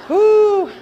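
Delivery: high, loud "whew" let out; big relieved whoop; loud whoo. Real, not acted. Preacher shuddering at nursery memories, loud whoo. Exclaims after nursery aside.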